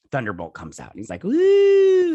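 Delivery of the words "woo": high, loud imitated whoop of triumph; a hero's cheer as his power bursts out; excited whoop. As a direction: let out a big mock whoop.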